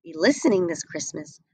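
usual speaking voice

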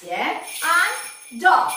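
normal talking voice

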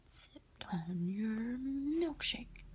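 whispered, slow, drawn-out movie villain misquote; misquoted movie line. Retold or imitated. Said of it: "I didn't quote it all the way right".

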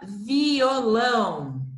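"violão" stretched syllable by syllable, very slowly; slow reading. Shown as a demonstration. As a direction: sound out vi-o-lão for the class. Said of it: "E a última".

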